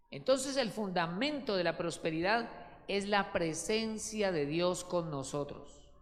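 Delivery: ordinary talk, nothing marked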